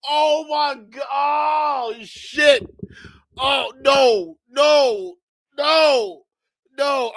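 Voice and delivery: loud, pained laughing cries; laughing shout. Real, not acted. Streamer yelling at the clip, laughing and pained. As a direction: yell in disbelief.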